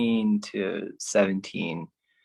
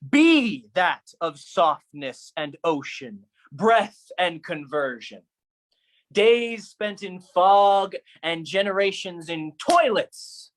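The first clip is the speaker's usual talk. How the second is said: ranting poem reading; 9 semitones higher than usual. Acted. Performing angry teenage poem to a crowd, ranting and declaiming. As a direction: rant the verse like a furious teen prophet. Chapter two, poem grows frantic.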